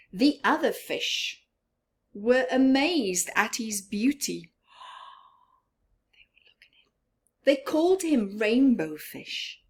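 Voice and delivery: lively read-aloud to a class; storybook narration. A narrator's reading. Teacher reading to her class, lively storybook voice. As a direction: read it like a teacher with picture book.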